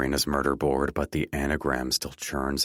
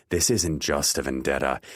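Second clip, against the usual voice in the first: usual speaking voice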